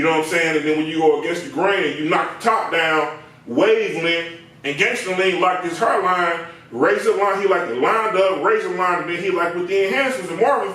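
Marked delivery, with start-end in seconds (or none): none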